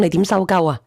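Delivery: ordinary talk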